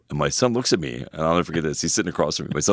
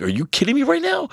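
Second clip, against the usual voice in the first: mock outrage. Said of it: "he got really mad at me".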